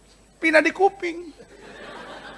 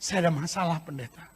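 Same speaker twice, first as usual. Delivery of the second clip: much softer, lower complaining plea; whiny quiet complaint; 3 semitones lower, 10 dB quieter than usual. Retold or imitated. Churchgoer telling the pastor he fell asleep, softly whining. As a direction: mumble it like a tired excuse. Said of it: "ada orang bilang sama saya".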